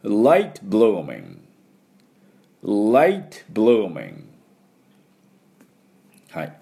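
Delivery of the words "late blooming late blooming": "late blooming" very slowly, vowels stretched; slow, emphatic "late blooming" for learners; repeat-after-me model. Shown as a demonstration. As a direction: model each word long with a falling tune. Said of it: "これ私の後で発音いきましょ".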